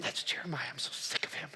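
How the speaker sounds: hushed, breathy contempt; sneering whisper. Retold or imitated. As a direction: whisper it behind his back. Market crowd sneering at Jeremiah.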